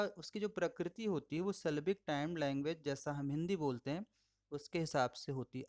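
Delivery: ordinary talk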